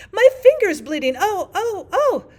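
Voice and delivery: loud, high panicked Queen screams; screaming. A narrator's reading. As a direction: scream it like a steam whistle. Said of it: "Her screams were so exactly like".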